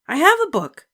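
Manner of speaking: put-on a stubborn little girl digging in; Ramona, little girl. A narrator's reading. Ramona telling her sister, high stubborn child.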